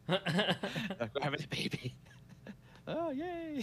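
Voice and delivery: giggly mock cheer; laughing. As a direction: laugh through the words. Mock excited baby announcement.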